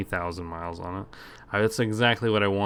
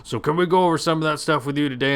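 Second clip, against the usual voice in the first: imitating a canned telemarketer, louder and monotone; robot salesman; 6 semitones higher, 7 dB louder than usual. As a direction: rattle off the script like a machine. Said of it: "this guy sounds like a fucking robot".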